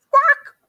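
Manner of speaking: high, loud teasing quack; mock quack. A narrator's reading. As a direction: quack back at Duck like a rooster. Rooster mimics quack.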